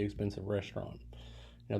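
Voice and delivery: ordinary talk, nothing marked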